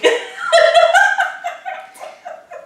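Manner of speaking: high, loud burst of laughter; laughing. Real, not acted. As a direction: crack up at the teasing. Laughs after being teased.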